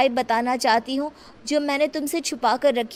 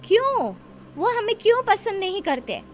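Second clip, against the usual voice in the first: high, hurt questions; puzzled questioning; 8 semitones higher than usual. Acted. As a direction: ask why, genuinely baffled. Child questions elder, upset.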